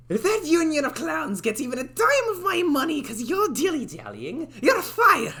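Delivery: very high character rant climbing to a shout; acted angry shout. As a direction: yell the firing at full tilt. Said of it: "shouldn't be shouting that loudly".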